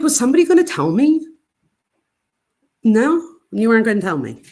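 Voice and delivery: loud, high, incredulous retort; indignant. Retold or imitated. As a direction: demand an answer in mock outrage. Retelling own indignant reaction to aunt.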